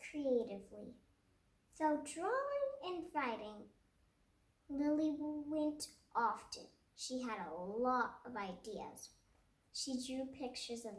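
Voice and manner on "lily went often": ordinary delivery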